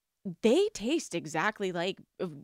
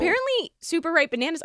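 amazed; 9 semitones higher, 5 dB louder, faster than usual. Real, not acted. Marvels at banana fact.

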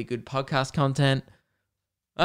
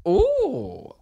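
very high, far-carrying "ooh"; hooked by a juicy question; intrigued ooh; 3 dB louder than usual. Real, not acted. Reacting to a question.

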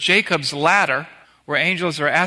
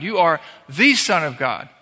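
ordinary talk

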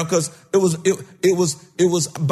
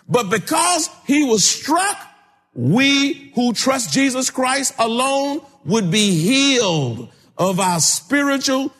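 fervent preaching; 6 semitones higher than usual. Acted. Preacher to congregation on healing through Christ, fervent preaching. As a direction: call it out from the pulpit.

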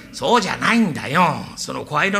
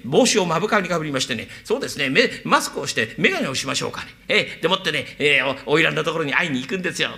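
ordinary delivery

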